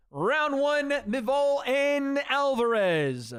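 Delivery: high, drawn-out announcer call; Crypt Keeper. Pretend. Streamer announcing round one, Crypt Keeper-like voice. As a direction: stretch the names out in a high, creepy announcer voice. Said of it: "I sounded like the Crypt Keeper".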